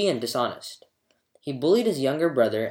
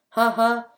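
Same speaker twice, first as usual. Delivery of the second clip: much higher tinkling laugh; fairy laugh; 9 semitones higher, 4 dB louder than usual. A narrator's reading. Bathing fairy laughs at the mortals' ignorance, gleeful laugh.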